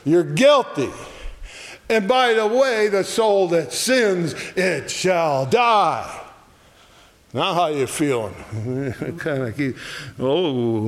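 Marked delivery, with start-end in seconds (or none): 0.1-6.2 raised, higher-pitched pronouncement of doom; loud voice. Acted. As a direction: proclaim the sentence loudly. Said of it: "it's this loud voice".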